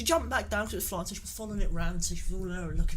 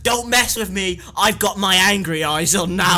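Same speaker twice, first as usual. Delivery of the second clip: louder, mock threatening teacher; loud stern warning; 8 dB louder than usual. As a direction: warn them loudly.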